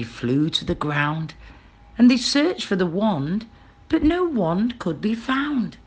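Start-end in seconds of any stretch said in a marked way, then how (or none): none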